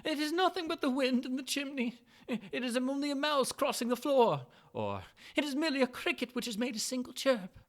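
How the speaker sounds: a frightened man talking himself calm; lightly voiced old man muttering comfort; old man soothing himself. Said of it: "He had been saying to himself".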